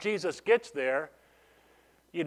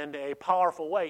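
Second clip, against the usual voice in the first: ordinary talk, nothing marked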